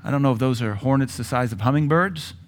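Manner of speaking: ordinary talk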